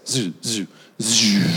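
low, soft buzzing sound of a dying phone; imitated dying phone. Telling the congregation his phone died, mock buzzing noise. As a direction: hum it out like the phone fizzling.

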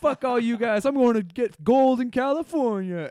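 very high, loud mock shout; defiant declaration. Oregon Trail pioneer leaving everyone behind, mock-defiant shouting. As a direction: proclaim it with hammed-up bravado. Acting pioneer storming off west.

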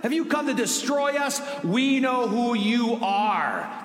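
demon's shouted challenge, dragging out the final line; crying out. Acted. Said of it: "he just cries out".

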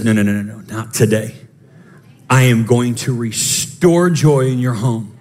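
God gently refusing to let the day win. Acted. Preacher voices God refusing.

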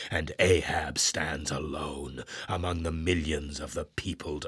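proud and alone, scorn turning bleak; defiant old captain. Acted. Ahab scorns his mates, defiant then bleak.

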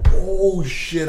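high, loud shocked curse; panicked curse. Retold or imitated. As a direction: blurt it in panic. Car stuck in the pothole.

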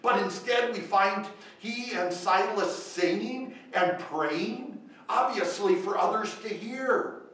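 loud, slow, rising preacher questions; full-force preaching. Acted. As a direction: drive each question home to the congregation.

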